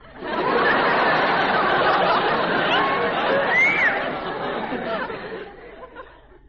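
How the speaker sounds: long high laugh. Acted. Husband laughs at wife's joke.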